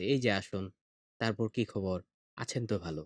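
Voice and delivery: "এই যে আসুন" said softly and slowly, each phrase set apart by a pause. Shown as a demonstration. Poet greeting the guest correctly in akkhorbritto, soft slow and pausing. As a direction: greet gently and unhurriedly, pausing between "তারপর কি খবর?" and "আছেন তো ভালো?". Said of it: "কত আস্তে আস্তে".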